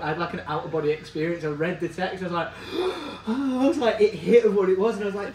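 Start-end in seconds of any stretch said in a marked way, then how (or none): 2.5-3.2 sharp high gasp of shock; retold gasp. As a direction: gasp as if the news just hit. Retelling his reaction to text.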